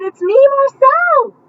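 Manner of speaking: high, loud and slow call; joyful shout. Acted. Farfalina answering her old friend, high joyful shout. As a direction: cry out your name in delight. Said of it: "he shouted".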